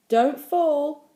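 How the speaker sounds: loud, high call of warning. A narrator's reading. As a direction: call out a caution from a passing bicycle. Warning called to child on gate.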